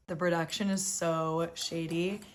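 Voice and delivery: ordinary talk, nothing marked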